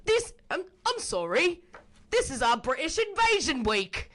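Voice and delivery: high, put-on British accent; hammed-up British announcement, slipping toward Australian. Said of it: "What's your best British accent".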